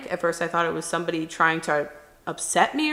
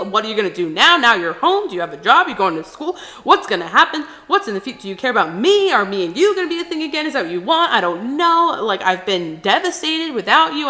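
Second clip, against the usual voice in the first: retold angry tirade, raised and loud; acted outraged yelling at an ex; angry screaming; 3 semitones higher, 5 dB louder than usual. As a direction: fire off accusing questions at full voice. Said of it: "I'm screaming".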